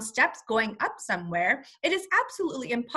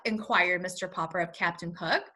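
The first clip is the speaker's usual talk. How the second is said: plain reading voice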